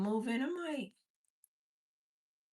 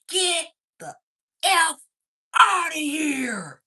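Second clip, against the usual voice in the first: higher, louder, drawn-out mock holler; more pronounced shout; 6 semitones higher, 5 dB louder, much slower than usual. Gamer hollering through the house, more pronounced shout. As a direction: imitate a man hollering, stretching the last words. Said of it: "now it's even more pronounced".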